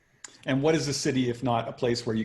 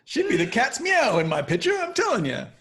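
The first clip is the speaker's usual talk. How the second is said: high 1940s Hollywood director voice; old-timey director; 13 semitones higher, 6 dB louder than usual. Pretend. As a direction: rave like an old-time picture man. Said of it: "old-timey voice".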